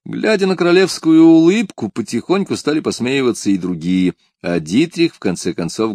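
plain reading voice

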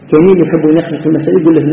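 normal talking voice

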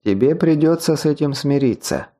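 usual speaking voice

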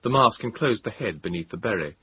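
ordinary delivery

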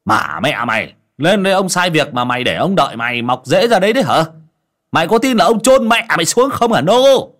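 furious shouting master; angry master. Acted. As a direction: bellow at the lazy servant. Master yelling at servant.

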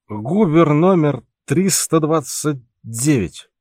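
put-on slow, slurred drunken call; drunken shout. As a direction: bellow it like a drunk sailor. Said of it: "пьяно выкрикивал Пауль".

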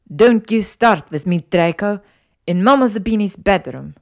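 put-on affronted Italian-accented scolding; indignant scolding. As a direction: tell him off forcefully. Italian matron scolds Draco.

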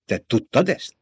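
mildly sharp, accusing question; angry rebuke. A narrator's reading. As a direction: turn on them with restrained anger. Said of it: "förmött rá Jessica". (visszafogottan ráförmedve)

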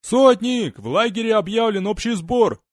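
high urgent shout; urgent call. Acted. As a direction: call out the alarm. Scout runs up calling out.

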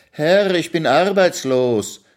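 slow, high-pitched plea; pleading servant. A narrator's reading. Hungry servant begs the master for food, pleading. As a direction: plead upward to your master.

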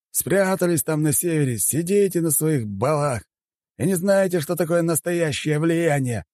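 high-pitched, offended official; indignant chairman. Acted. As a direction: lecture the caller in high-voiced indignation. Chairman rebukes caller. (высокий возмущённый голос председателя)

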